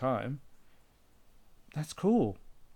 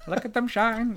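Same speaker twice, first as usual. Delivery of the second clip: quoting the show; 8 semitones higher than usual. Retold or imitated. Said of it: "And his shiny mirror balls".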